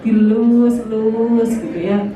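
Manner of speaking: slow, tender, drawn-out Dilus-lus; tender stroking. Shown as a demonstration. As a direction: say it like gently caressing a spouse. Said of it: "Yo, mesrane tenan". (pelan dan mesra, seperti mengelus pasangan)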